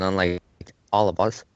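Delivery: normal talking voice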